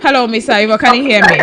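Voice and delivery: loud hello down a bad line; calling out. Real, not acted. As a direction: call out to a faint caller.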